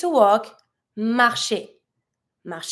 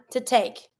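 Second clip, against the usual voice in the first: ordinary talk, nothing marked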